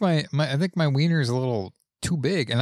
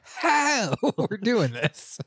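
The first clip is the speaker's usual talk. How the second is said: mock surprised quote; 9 semitones higher, much slower than usual. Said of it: "I'm like".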